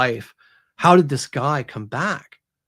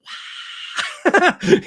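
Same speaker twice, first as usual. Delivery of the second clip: high, loud mock yell; imitated scream; 5 dB louder than usual. Woman caught by dead hand, high mock scream. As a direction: give a big yodelling scream. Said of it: "the big yodel".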